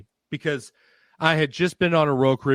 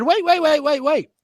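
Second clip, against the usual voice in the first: high, loud urgent "wait, wait"; 5 dB louder than usual. Retold or imitated. Dad cutting off the theory, high excited call. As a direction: stop them with a sudden high call. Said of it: "And I said".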